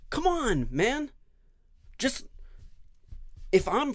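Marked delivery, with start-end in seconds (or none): 0.1-2.3 high, loud come on; loud exasperated appeal to everyone; exasperated plea. Real, not acted. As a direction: plead in exasperation.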